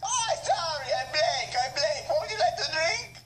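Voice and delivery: high, cartoonish chef voice; a waiter greets his customer, high and goofy. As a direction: play a silly Italian-style waiter, squeaky and eager. Waiter repeats order.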